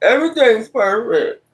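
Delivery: high-pitched mock perfection; put-on high voice, sending them up; mocking perfect people. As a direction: mimic them in a high, prissy lilt. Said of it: "Everything gotta be just perfect".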